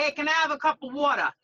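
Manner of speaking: quick, run-together Bronx "wawtuh"; Bronx accent. Shown as a demonstration. Bronx groomer teaching British hosts her saying, Bronx accent. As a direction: say it like a true Bronx local. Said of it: "from the Bronx if you say".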